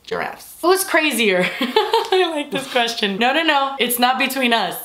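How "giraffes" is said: very low, soft, whispery word; hushed. Pretend. Repeating the nursery theme, hushed. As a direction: drop it right down to a whisper.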